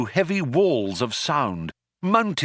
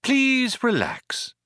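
slow, high, soothing voice; pleasant; 11 semitones higher, slower than usual. A narrator's reading. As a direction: reassure like a stewardess, drawing it out. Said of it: "said the voice pleasantly".

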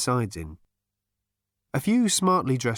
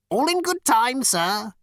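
high, loud attendant's call; polite flunky; 13 semitones higher, 5 dB louder, slower than usual. Acted. Flunky's figure voice in the story.

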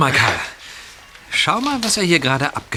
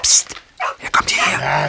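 urgent whisper. Acted. Warning friend while hiding.

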